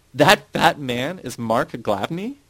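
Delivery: loud, high, drawn-out incredulous question. A narrator's reading. Narrator's involuntary outburst to Titus, very loud question. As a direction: call it out slowly in amazed disbelief. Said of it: "burst out laughing and asked very loudly".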